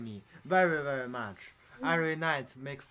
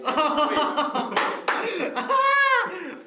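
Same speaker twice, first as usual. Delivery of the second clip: loud high laughter; big laugh; 12 dB louder than usual. Real, not acted. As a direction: burst out laughing. Laughing at the ventriloquist joke.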